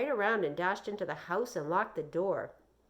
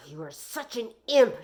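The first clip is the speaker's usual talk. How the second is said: growled; 4 semitones higher, 9 dB louder than usual. Acted. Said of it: "she growled".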